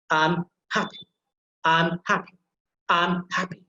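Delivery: emphasis on the HA of "happy"; stress on happy's first syllable. Shown as a demonstration. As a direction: say "happy" with weight on HA, light final E. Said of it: "You have to put emphasis there".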